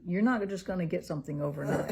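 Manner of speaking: normal talking voice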